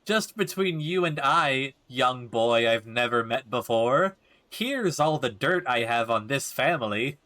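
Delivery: slow, drawn-out mock confiding; sarcastic mocking. Player mocks the neighbor gossiping to a strange boy, drawn-out sarcasm. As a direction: parody the gossip word by word.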